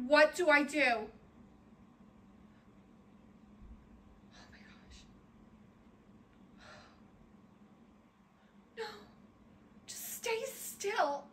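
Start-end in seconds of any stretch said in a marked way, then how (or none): 0.8-8.8 faint, frightened whisper; hushed real fear; whispered dread. Real, not acted. Watching spider in dread. 9.9-11.2 slow, very soft plea; hushed, drawn-out pleading. Real, not acted. Pleads with the spider.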